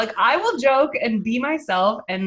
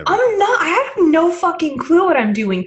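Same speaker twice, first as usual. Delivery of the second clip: high, loud and gleeful outburst; loud exclaim; 6 semitones higher, 5 dB louder than usual. Real, not acted. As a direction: blurt it out loud and high, half laughing. Laughing self-mocking admission.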